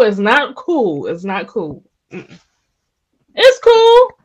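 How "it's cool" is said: high-pitched, breezy brush-off; breezy catchphrase. Shown as a demonstration. Man doing his own line, high and breezy. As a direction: wave it away in a high, light voice. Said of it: "That's my line".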